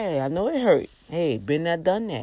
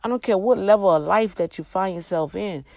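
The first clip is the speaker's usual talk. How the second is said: ordinary talk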